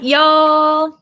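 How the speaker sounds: high, loud mock Southern drawl; imitated Southern, words run together; Southern accent. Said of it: "then you get the South".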